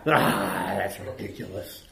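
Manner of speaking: mock heckler's grumble of disbelief; scoffing. Shown as a demonstration. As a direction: wave it away under your breath. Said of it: "if I'm preaching and somebody's scoffing".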